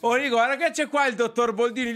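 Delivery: high, loud mocking impression; mocking imitation. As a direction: send him up for the guests. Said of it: "mi fai l'imitazione".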